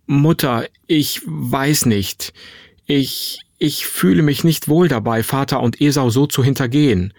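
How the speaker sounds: falter, searching for the words; hesitant objection. Acted. Son hesitates over deceiving father. (zögernd, stockend)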